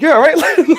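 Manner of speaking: high, laughing imitation of himself; half-laughing, playing his own flustered self; mock panic. Sitter retelling his own flustered reply to the boyfriend, high laughing mock voice. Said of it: "I was like".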